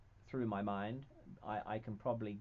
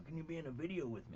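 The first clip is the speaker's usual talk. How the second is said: imitating The Rock asking; 6 semitones higher, 4 dB quieter, faster than usual. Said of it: "all of a sudden, you know".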